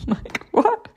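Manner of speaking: high surprised laugh; laughing surprise. Real, not acted. Surprised by the horse calling goats children, laughing high.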